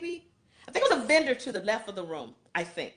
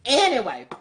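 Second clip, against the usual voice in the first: ordinary talk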